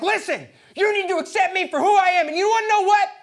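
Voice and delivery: very high, loud, hammed-up rant; angry yelling. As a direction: yell your case in acted indignation. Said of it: "You can't yell at me".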